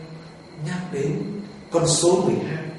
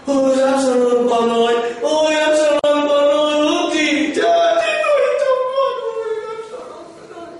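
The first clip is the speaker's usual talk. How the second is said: high keening wail, voice climbing and breaking; David's wailing lament; 9 semitones higher than usual. Retold or imitated. David weeping over his dead son Absalom, high wailing lament. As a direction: cry out for a lost son, rising to a wail. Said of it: "ông òa lên khóc".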